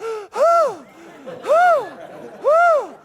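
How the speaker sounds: big high gasp of shock; imitating a man gasping for air. Doctor in the elevator gasping loudly in shock. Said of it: "the guy's going".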